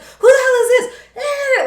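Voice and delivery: very high, loud mock panic; going nuts. Everybody reacting to the sweater, going nuts. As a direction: squeal it in comic alarm. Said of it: "like, they're going nuts".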